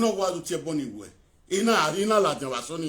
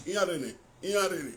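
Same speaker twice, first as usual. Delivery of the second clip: usual speaking voice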